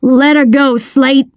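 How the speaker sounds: restrained commanding shout; shouted order. A narrator's reading. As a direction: order him to release the child. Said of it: "the Colonel shouted".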